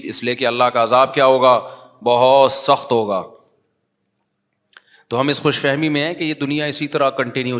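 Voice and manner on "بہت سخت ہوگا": slow, heavy and louder; solemn warning; grave emphasis. Real, not acted. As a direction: weigh down each word. Stresses severity of punishment.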